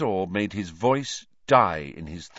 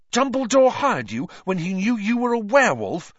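high, loud, incredulous question; gasped; 11 semitones higher, 6 dB louder than usual. A narrator's reading. Ron learning the staff knew, gasping. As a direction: gasp it out in disbelief. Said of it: "Ron gasped".

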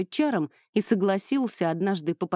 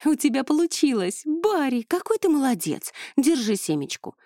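excited praise; 7 semitones higher, 4 dB louder than usual. Acted. Praising crow after success.

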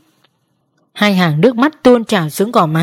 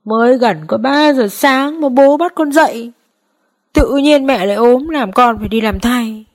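higher, lightly voiced complaining boy; whiny tired son; 5 semitones higher than usual. A narrator's reading. Son complaining to his father about waking early, higher whiny tone. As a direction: grumble like a sleepy kid. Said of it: "Thằng con có vẻ đã mệt mỏi".

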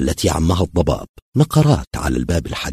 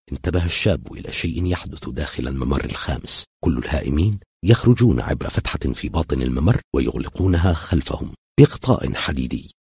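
ordinary delivery, nothing marked

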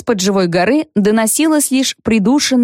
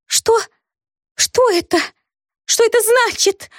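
high, far, frightened babble; babbling in fright; 13 semitones higher than usual. A narrator's reading. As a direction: stammer it out in shock. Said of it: "пролепетала она".

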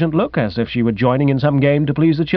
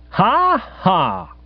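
high, deliberate "ha, ha"; spoken laugh, pitched well up; cheery mock guffaw; 10 semitones higher than usual. A narrator's reading. Guest laughing off the accusation, forced cheery guffaw. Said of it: "A cheery guffaw seemed in order".

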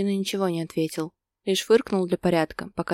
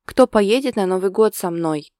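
louder, indignant question; flaring up; 7 dB louder than usual. A narrator's reading. Girlfriend with nobody to travel with, flaring up. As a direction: flare up with mild indignation. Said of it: "взвилась она".

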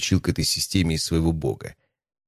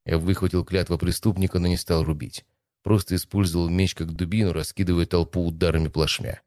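usual speaking voice

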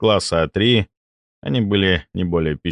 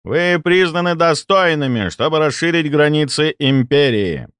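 booming speech; 8 semitones higher than usual. Acted. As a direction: proclaim it to the crowd. Viceroy's speech through microphones.

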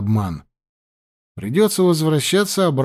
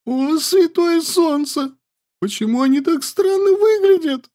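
frightened wail. Acted. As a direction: cry out in horror. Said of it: "Yandi frightened".

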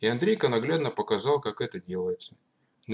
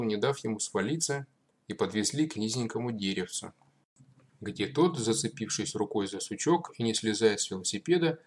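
usual speaking voice

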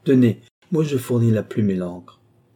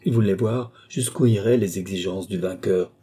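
ordinary delivery, nothing marked

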